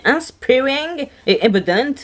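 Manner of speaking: names read with a light English accent. Shown as a demonstration. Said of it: "j'ai mis un accent". (avec un petit accent anglais)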